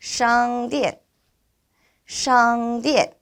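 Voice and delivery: slow 샹디엔, held high then falling; repeat-after-me model. Shown as a demonstration. Teacher leading students to read 샹디엔, careful model. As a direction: keep 샹디엔 high and level, drop at the end. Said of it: "따라서 읽어보도록 하겠습니다".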